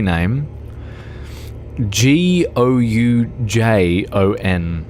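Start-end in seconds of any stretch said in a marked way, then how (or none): 1.9-4.8 slow letter by letter G O U J O N; spelled out. Shown as a demonstration. Player spells the dish name to viewers, slowly. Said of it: "spelling the word".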